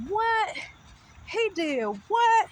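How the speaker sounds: imitated high, loud double take; shocked disbelief. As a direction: act out the twin's stunned outburst, drawing out the end. Twin's shocked reaction acted out.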